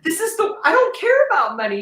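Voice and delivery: loud, high indignant protest; retold anger. Protesting to her caseworker about the job, heated. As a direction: push back hard on the caseworker, pitched high. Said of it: "pissed off".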